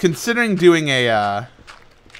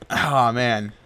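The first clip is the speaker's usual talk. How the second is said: usual speaking voice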